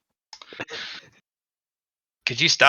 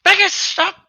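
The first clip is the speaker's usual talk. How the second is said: very high panicked squeaky voice; panicked squeal. Acted. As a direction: shriek it in disgusted panic. Skull panicking, very high pitched.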